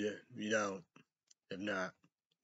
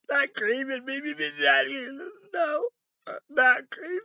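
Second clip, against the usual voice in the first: mock whine; 8 dB louder than usual. Mock protest, laughing.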